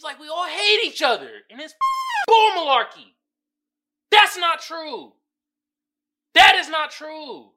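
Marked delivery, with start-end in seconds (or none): 2.3-7.5 louder, slow and insistent denial; emphatic. Real, not acted. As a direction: hammer it home that it's false. Angry rebuttal of gender war.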